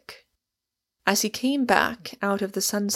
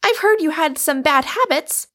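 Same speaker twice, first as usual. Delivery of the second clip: raised, loud accusing voice; agitated; 8 semitones higher, 7 dB louder than usual. A narrator's reading. Rosamond confronts Michael about bad habits, agitated. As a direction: press on in agitation, higher and louder. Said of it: "not without agitation".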